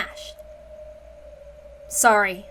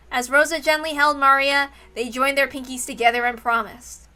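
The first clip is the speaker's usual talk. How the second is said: normal talking voice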